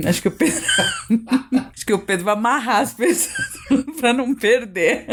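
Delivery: falando entre risadas; laughing. Real, not acted. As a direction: rindo enquanto conta. Rindo da ideia da cordinha. (fala entre risadas)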